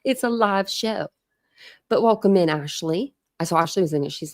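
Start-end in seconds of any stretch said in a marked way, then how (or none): 0.0-1.1 slow, deliberate "It's a live show" as a tongue-in-cheek alibi; mock excuse. Shown as a demonstration. Host trying out her new excuse on chat, slow and mock-serious. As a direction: drag it out like a handy excuse. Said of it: "I'm gonna start using that as my excuse".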